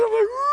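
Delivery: high, loud mock wail; hammed-up high cry; overwhelmed ooh. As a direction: re-enact an overcome "ooh". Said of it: "And I'm like".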